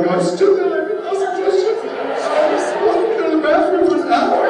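high, squeaky audience voice; a high, squeaky imitation of a restless audience; mock impatient audience. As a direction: mimic the bored crowd in a high squeak. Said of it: "you're all standing there and you're going".

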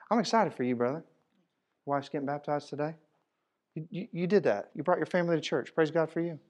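quiet friendly compliment demo; demonstrated friendly compliments. Shown as a demonstration. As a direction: show how casually you'd compliment someone. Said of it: "See how easy that is?".

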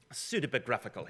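lightly imitated British accent; softly spoken British-accented imitation. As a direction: quote a British scholar in his accent. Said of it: "he's a British guy".

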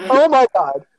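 high, loud imitation of dad; dad's shocked exclamation. Son mimics his dad discovering the toilet, shocked. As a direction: mimic dad's horrified outburst. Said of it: "he goes".

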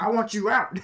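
high, loud mock outburst; hammed-up landlord shout; retold eviction demand. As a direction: imitate a landlord who has had enough. Retold landlord finally ordering tenant out.

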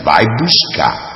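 high, drawn-out order; crazed demand. A narrator's reading. Crazed young woman orders the crying boy, drawn-out high demand. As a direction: stretch the command and lift it high. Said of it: "a louca dizia".

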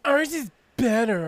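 high, loud smug boast voice; mock boast. Mocking the Xbox boast, high and loud. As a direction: puff up like a bragging rival.

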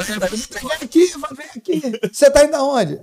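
high, imitated motherly summons; alarmed calling back. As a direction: demand it like mom stopping her son. Mother calls departing son back.

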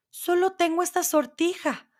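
high maiden voice; maiden's voice. A narrator's reading. Maiden offering her only ring to the dwarf, high maiden voice. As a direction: lift it high for the young maiden. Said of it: "dijo la doncella".

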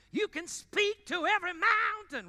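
high, loud, ringing preacher cry; Pentecostal preaching voice. Shown as a demonstration. Pastor shows the church his Pentecostal voice, loud and high. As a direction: belt it like a revival preacher. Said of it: "that's my real Pentecostal voice".

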